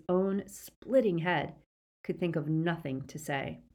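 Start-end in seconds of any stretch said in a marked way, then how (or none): none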